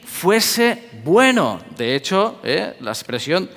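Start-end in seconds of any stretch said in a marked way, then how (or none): 0.0-1.6 very slow, stretched out; drawn-out emphasis. Acted. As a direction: stretch fuese bueno slowly to stress it. (muy lento, alargando «fuese bueno»)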